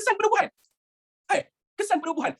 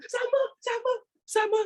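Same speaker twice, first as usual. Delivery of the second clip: much higher, singsong sama; high mock student reply; 14 semitones higher, slower than usual. Teacher voicing a pupil's answer, high and singsong. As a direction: squeak it out like a pupil.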